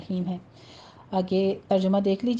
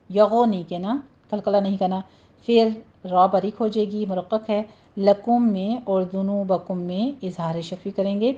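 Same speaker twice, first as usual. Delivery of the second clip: ordinary delivery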